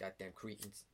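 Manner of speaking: put-on Boston accent. Bartender griping at customers who can't hear, Boston accent. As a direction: curse under your voice in Boston style. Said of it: "in a Boston accent".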